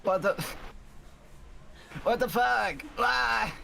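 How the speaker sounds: high startled cry. Real, not acted. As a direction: jump at a sudden scare. Startled reaction to scare.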